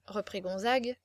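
ordinary delivery

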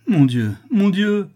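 high, drawn-out lament; loud, slow, high wail of distress; anguished cry. Acted. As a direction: cry out to God in despair. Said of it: "s'écria le lapidaire".

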